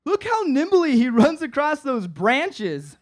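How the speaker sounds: slow, drawn-out, amazed and giggly; mock awed stoner. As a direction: marvel at it like a stoned fan. Stoned friend admiring movie.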